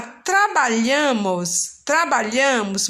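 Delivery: "trabalhamos" drawn out slowly, leaning on the AM syllable; slow teacher's demo of "trabalhamos", AM stressed and held. Shown as a demonstration. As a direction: stretch the strong AM in "trabalhamos". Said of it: "a sílaba mais forte aí é o an".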